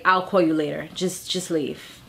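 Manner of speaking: low, softened plea to leave; low-key dismissal. Acted. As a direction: send them off quietly, pitch dropped. David acted, sending Maggie away.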